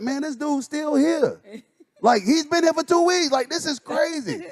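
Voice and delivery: high-pitched exasperated griping; retold frustration at a lingering worker, voice high and strained; frustrated rant. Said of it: "I'm not mad at you".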